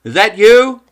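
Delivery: high, loud call from afar. Acted. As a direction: shout down through the fog. Calling from above in fog.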